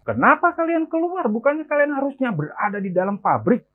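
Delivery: high-pitched scolding cry, no real boom; angry yelling. Acted. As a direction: yell it in a high, indignant voice. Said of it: "Dia pun berteriak kepada mereka".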